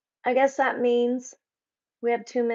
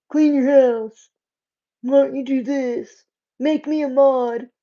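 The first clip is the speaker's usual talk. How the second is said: loud, nagging impersonation of a rude commenter; mock heckler; 3 semitones higher, 6 dB louder than usual. Streamer imitating strangers who mock her in chat, mock heckling. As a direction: bark demands like a pushy chat troll.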